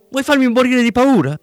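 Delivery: high-pitched hysterical wife shouting into the phone; hysterical yell. Acted. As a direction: shriek it in panicked fury. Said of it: "gridò isterica la moglie".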